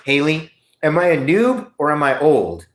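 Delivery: normal talking voice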